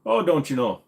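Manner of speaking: Minnesotan. Shown as a demonstration. Streamer showing viewers his Minnesotan sayings, Minnesotan accent. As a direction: sing out a Minnesotan catchphrase. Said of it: "I say, "Don't you know?".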